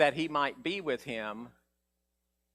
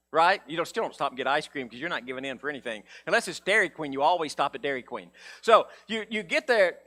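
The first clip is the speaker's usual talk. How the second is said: normal talking voice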